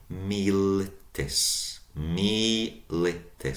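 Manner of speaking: militis, slow, long mi then short li and tis; long first syllable. Shown as a demonstration. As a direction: stretch mi in militis. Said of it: "So long first syllable".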